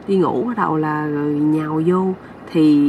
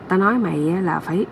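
ordinary delivery, nothing marked

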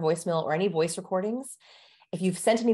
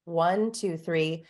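normal talking voice

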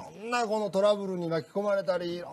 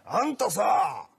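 mock scolding; 4 semitones higher than usual. Old man scolding the masseuse, mock angry. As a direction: drag out the complaint, then snap. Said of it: "おっちゃんの 怒号".

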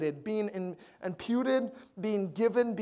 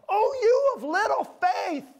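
much higher, louder, slow scolding voice; harsh Jesus; 10 dB louder, slower than usual. Pretend. Preacher voices a harsh Jesus to the congregation, loud high scolding. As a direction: play Jesus as a stern, exasperated scold. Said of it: "I wonder if you hear him go".

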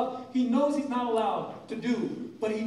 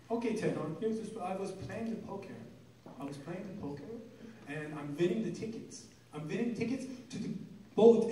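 low, very soft Persian dad murmur; dad's Persian accent; 4 semitones lower, 8 dB quieter than usual. Retold or imitated. Dad telling son how he met mother, low soft accent. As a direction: hush it down like a tired Persian father. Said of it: "he'd be like".

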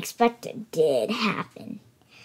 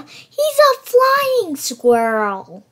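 higher, louder, slow triumphant announcement; excited reveal; 7 semitones higher, 7 dB louder, much slower than usual. A narrator's reading. Revealing to kids the squirrel can fly, excitedly. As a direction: stretch it out in wonder.

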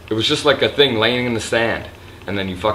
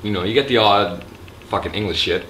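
normal talking voice

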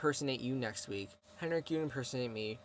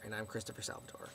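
breathy whispered aside, very soft; 3 semitones lower, 11 dB quieter than usual. Real, not acted. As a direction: drop to a whisper for the punchline. Whispered punchline about her name.

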